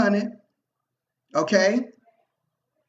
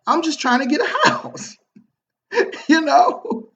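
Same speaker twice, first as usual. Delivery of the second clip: real laugh breaking through the words; chuckling while talking, high laugh at the end; 7 semitones higher than usual. Real, not acted. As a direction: laugh as you tell it, amused at yourself. Laughing at his own story.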